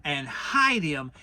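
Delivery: a self-important rabbit holding forth; fussy Rabbit voice. A narrator's reading.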